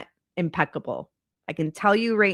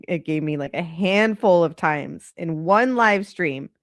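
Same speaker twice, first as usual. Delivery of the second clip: ordinary talk, nothing marked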